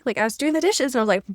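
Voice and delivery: usual speaking voice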